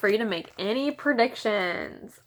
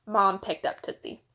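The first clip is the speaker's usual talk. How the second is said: ordinary delivery, nothing marked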